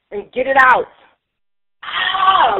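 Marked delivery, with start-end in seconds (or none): 1.8-2.6 release scream. Shown as a demonstration. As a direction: let out a raw scream. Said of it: "you can just go".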